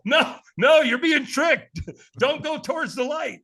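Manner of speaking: panicked inner warning. Retold or imitated. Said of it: "I'm thinking".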